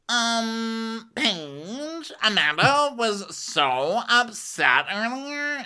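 put-on high, drawn-out girlish voice; hammed-up high-pitched tattling; mock gossiping girl. Friend telling Paige that Amanda was upset, mock gossip.

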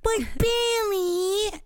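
high drawn-out wail of a name; mock whine. Mimicking a show character pleading with Bailey, mock whine. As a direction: whine it long and high, hammed up.

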